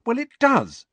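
quick, high, exasperated retort; brisk impatience at the boys; politely impatient. A narrator's reading. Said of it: "she said impatiently".

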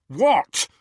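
loud, high angry snarl. A narrator's reading. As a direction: fire it back furiously. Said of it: "he snarled at Hermione".

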